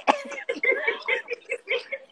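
high delighted laugh. Real, not acted. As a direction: burst out laughing. Laughing at the memory.

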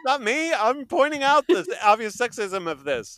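high, loud, laughing denial; laughing protest. Real, not acted. Cohost jokes he is in trouble, high laughing protest. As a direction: wave off the blame through laughter.

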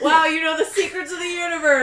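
loud, high, laughing mock wonder; teasing the know-it-alls with a laughing shout; laughing mock awe. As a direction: gush sarcastically through laughter.

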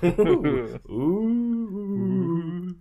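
high cackling witch ooh; witch laugh. Pretend. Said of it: "I was doing a witch laugh".

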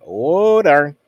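unbothered oh darn. Retold or imitated. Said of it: "instead of the scream, it's like".

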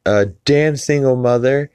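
high, loud, hammed-up complaining; mock sitcom mother. Sitcom mother cursing at Julie, high loud mock exasperation. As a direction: imitate an exasperated sitcom mum, punching each "damn". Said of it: "Alex Borstein does the voice".

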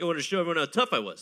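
put-on fast, rushed drunk boast; mock drunk boast. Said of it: "you're like".

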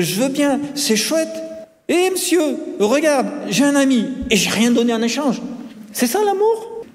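put-on high, excited child voice; delighted boy. A narrator's reading. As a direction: tell teacher the news, thrilled.

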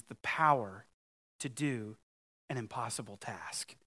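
hushed, breathy whisper of the last words. Acted. Pastor to congregation, whispering the impossible task. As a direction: let it sink in with a whisper. Reads Miller's parenthetical, hushed.

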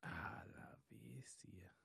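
slow, breathy ominous whisper; dramatic whisper. Pretend. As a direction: whisper it slowly like a dread omen. Facing cannibal tribe, dread.

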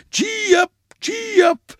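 very high, loud driving calls. A narrator's reading. Grandpa alone in his travelling bed, crying gaily. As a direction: urge the horses on gaily at full voice. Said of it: "his voice crying loudly and gaily".

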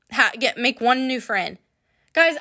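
ordinary talk

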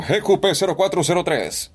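reading a plate in an altered voice; playful voice exercise; put-on voice. Shown as a demonstration. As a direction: try a different voice on the plate. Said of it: "Hago cualquier voz".

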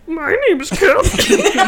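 high, slow, loud imitation; slow, mocking self-introduction, laughing; Catherine impression. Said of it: "Holy fuck, that's me".

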